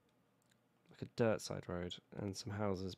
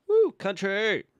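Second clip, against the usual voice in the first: high, loud triumphant yell; excited whoop; 11 semitones higher, 14 dB louder, slower than usual. Real, not acted. Builder lands a tricky road and whoops with loud excitement. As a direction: cheer at the top of your voice. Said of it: "At the detriment to my voice".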